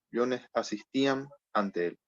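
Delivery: ordinary talk, nothing marked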